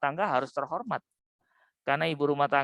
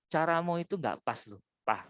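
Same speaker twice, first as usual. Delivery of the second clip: gentle request; 5 semitones lower, 6 dB quieter than usual. Acted. Ngomong baik-baik.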